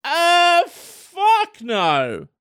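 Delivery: high uh then a falling fuck no; high then blunt. Shown as a demonstration. Repeating the demo refusal, high then flat. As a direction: jump up high, then drop it. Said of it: "Just like that".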